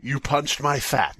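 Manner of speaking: usual speaking voice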